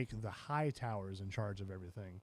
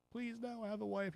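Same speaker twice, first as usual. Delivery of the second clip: high, soft begging; mock pleading; 9 semitones higher, 4 dB quieter than usual. Soldier begging the boss for mercy, mock pleading. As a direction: plead small and high, imitating a desperate man.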